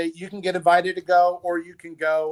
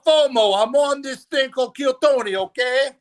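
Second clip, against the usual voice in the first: a hammed-up bad Jersey accent, higher and louder; laughing through a mock Jersey accent; terrible Jersey accent; 8 semitones higher, 4 dB louder than usual. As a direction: play a New Jersey guy badly. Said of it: "That was a terrible Jersey accent".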